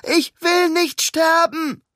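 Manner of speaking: slow, high, loud desperate cry; terrified plea. Acted. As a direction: beg for your life, drawn out. Frightened woman on roof edge. (verzweifelter, langsamer Schrei)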